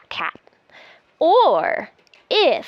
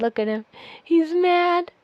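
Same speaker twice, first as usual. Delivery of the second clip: plain reading voice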